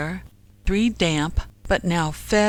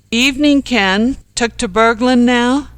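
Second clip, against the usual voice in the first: loud, higher-pitched cheeky greeting; calling out; 6 semitones higher, 7 dB louder than usual. A narrator's reading. Night owl teasing Ken from the bowling club, calling out. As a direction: hail an acquaintance across the street.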